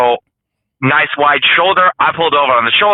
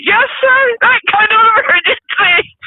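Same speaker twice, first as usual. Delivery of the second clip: sheepish squeak of agreement; high-pitched voice. Retold or imitated. Driver admitting to the cop it's that emergency, squeaky high. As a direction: confirm it in a squeaky, much higher voice. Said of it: "you had the high-pitched voice".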